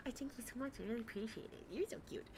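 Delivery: lightly mocking saccharine gratitude; mock sweet streamer. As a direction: coo it like a fake-nice host. Mimicking streamer's sweet thanks.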